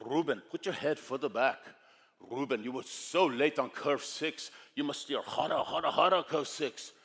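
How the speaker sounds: put-on firm Schwarzenegger-like Austrian accent; Austrian coach. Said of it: "sounds like Arnold Schwarzenegger".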